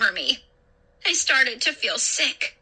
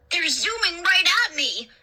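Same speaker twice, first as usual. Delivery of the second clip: higher-pitched frightened wail; panicky, raised-pitch complaint; 6 semitones higher than usual. A narrator's reading. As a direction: wail it in alarm. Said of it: "Luke wailed".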